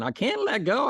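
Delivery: acted panic; whiny refusal. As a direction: repeat it like a scared child. Man keeps refusing.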